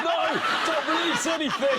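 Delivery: very high, loud mock panic; panicking tablet. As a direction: squeal an order to hold everything in. Voices tablets refusing to dissolve.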